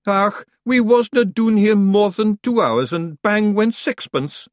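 loud, slow high Scottish grumble; indignant wee Scotsman; Scottish accent. Acted. Feegle voice.

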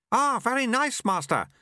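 very high, chirpy character voice; eager apprentice. A narrator's reading.

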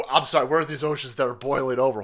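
ordinary talk, nothing marked

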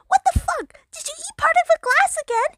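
high-pitched, mildly angry yelling; angry holler. A narrator's reading. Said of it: "he hollered angrily".

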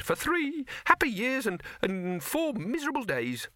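very high, wistful rabbit; rabbit character. Acted. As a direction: pipe it up as a small rabbit.

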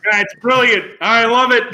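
loud, high gushing praise. Retold or imitated. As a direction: gush like a proud parent. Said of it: "You were like".

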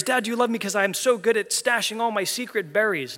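put-on child squirrel begging for praise; eager kid squirrel. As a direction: pipe up like an eager little kid. Kid squirrel voiced in story.

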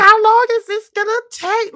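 high, loud incredulous complaint; exasperated disbelief. Retold or imitated. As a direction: pitch up in disbelief at the long kill. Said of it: "I'm like".

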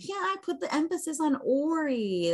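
high-pitched, mock-aggrieved complaint; exasperated protest. Real, not acted. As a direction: protest high, hitting "Ori". Playful protest over pronunciation.

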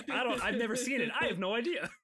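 high, loud, quick mock cluelessness; Tentomon impression. Playing Tentomon asked about Mithril, high flustered denial. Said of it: "And then Tentomon's like".

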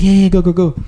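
high, insistent imitated urging; urgent go-ahead. Manager sending worker to family emergency, urgent.

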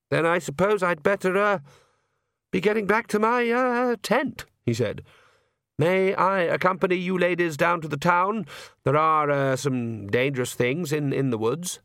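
a shy priest who can't finish a sentence; nervous young priest, flustered. A narrator's reading. Priest offering to escort.

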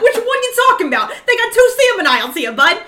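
high, loud New York ribbing through laughter; New York accent. Retold or imitated. Scolding boyfriend over two salmon aisles, New York accent. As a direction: heckle like a hammed-up New Yorker, laughing. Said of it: "I'm fucking from New York".

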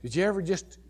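ordinary talk

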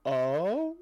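drawn-out rising questioning "oh"; mock puzzlement, sliding up in pitch; puzzled surprise. Retold reaction to British director surprise.

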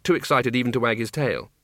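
ordinary delivery, nothing marked

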